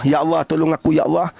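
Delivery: low, earnest plea to God; pleading prayer. Acted. Voiced prayer for help.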